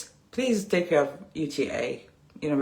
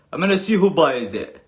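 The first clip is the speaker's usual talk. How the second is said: mock threat; 6 dB louder than usual. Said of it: "throwing off some threats".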